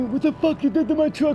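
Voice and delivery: high, loud furious yell; angry driver. Retold or imitated. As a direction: blow up at the kid. Angry driver voiced in story.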